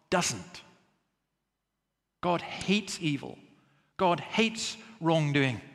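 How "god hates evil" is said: ordinary delivery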